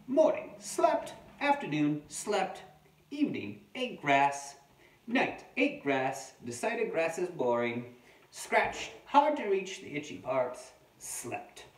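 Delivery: loud, high-swooping storybook entries; wombat diary narration. Acted. Adult reading a wombat's diary to children, punchy performed narration. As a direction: punch out each terse entry, then pause.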